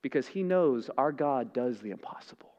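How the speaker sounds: whispered, hushed conviction. Real, not acted. Preacher whispering to his congregation in awe. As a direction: drop to an awed whisper.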